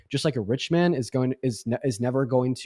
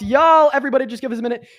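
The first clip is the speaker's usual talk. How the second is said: calling out to chat; 12 semitones higher, 7 dB louder than usual. Real, not acted. Calling out to stream audience.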